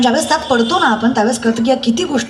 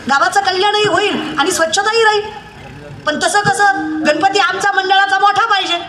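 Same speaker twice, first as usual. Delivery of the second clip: high-pitched, exhorting kirtan preacher; impassioned preaching; 8 semitones higher than usual. Acted. Preacher rebuking devotees over hollow Ganpati festival piety, high impassioned preaching. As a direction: keep the pitch up high and berate the congregation.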